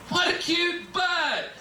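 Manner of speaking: put-on high, slow, gushing girlish voice; swooning woman. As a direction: drool over him in a high swoon. Women swooning over Satan.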